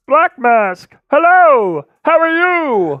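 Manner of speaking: high cartoon frog puppet voice; Kermit the Frog voice. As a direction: chirp a greeting like a puppet frog. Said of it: "Kermit the Frog".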